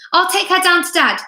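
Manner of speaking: put-on a girl calling as she leaves; called out. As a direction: project it across the room.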